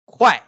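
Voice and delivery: "Kuai" loud, high start, abrupt fall; fourth tone. Shown as a demonstration. Teacher models last tone on kuai, forceful falling syllable. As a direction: snap "Kuai" downward sharply. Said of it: "the last tone".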